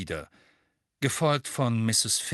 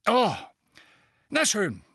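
higher, louder irritated snap; snapping; 14 semitones higher, 5 dB louder than usual. A narrator's reading. Fudge grudgingly conceding to Dumbledore, snapping. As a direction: give in with a sharp huff. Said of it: "fauchte Fudge".

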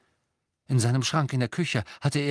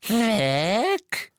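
high, louder croaking elf voice; 12 semitones higher, 4 dB louder than usual. A narrator's reading. Creature telling Harry the locket is gone. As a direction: croak it fearfully.

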